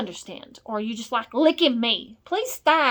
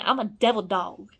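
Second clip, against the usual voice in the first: normal talking voice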